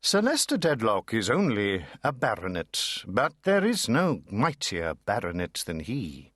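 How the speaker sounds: ordinary delivery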